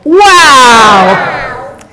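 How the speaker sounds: loud, excited cry of awe; big wow. Pretend. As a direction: lead the crowd in an amazed shout. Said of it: "can I get a wow?".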